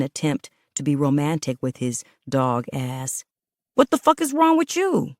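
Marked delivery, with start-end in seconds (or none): 3.7-5.1 sharp high angry snap. Acted. Man snapping back after she throws soap. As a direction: bite back at her, stunned and angry. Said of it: "he snapped".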